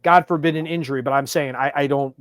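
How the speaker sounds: ordinary talk, nothing marked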